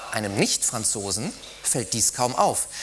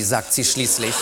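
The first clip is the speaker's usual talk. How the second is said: plain reading voice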